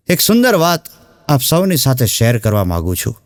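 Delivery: ordinary talk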